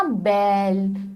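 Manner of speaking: बैल said slowly with the ai vowel held long; drawn-out vowel. Shown as a demonstration. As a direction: stretch बैल so children hear the long ai. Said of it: "तो बैल भी हमने खींच के बोला".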